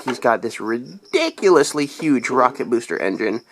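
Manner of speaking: ordinary talk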